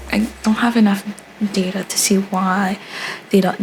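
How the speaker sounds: ordinary talk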